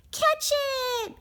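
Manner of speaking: very high, loud cry; elves crying out. A narrator's reading. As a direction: call the chase. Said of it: "cried the elves".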